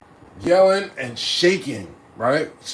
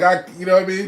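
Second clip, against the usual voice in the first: ordinary talk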